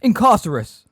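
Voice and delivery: high, very loud incantation; screamed spell. A narrator's reading. Umbridge attacking Magorian by wand, screamed spell. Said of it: "Umbridge pointed her wand at Megorian and screamed".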